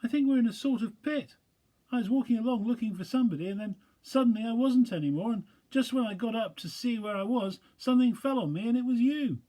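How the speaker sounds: small high squeaky animal voice, relieved; tiny timid creature, glad it's a friend; Piglet. Acted. Piglet voice held.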